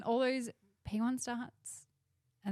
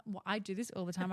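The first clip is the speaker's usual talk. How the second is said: usual speaking voice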